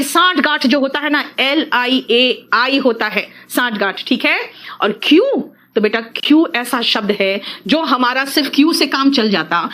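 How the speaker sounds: ordinary talk, nothing marked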